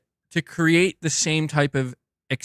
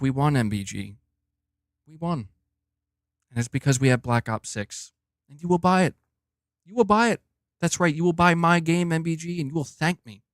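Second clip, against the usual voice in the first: a hint of a boss taunting a rival fan; Phil Spencer voice. Streamer voicing the Xbox boss gloating to him, lightly smug and mocking. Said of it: "I hear, like, Phil Spencer's voice".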